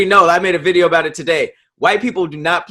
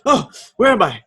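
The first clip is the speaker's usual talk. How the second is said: dog's dazed voice; 5 semitones higher than usual. Retold or imitated. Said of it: "He just".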